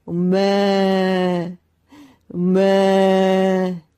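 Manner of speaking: slow, drawn-out loud mooing; a man trapped as a cow, mooing. A narrator's reading. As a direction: moo long and loud.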